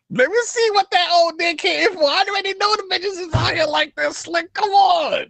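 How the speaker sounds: squeaky high-pitched mocking impression; hammed-up high falsetto. Mocking Shannon's stream voice.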